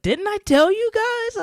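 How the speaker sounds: high, loud, slow told-you-so; gloating friend. Retold or imitated. Friend gloating after okay boomer craze, high smug voice. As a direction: stretch it out, smug and triumphant.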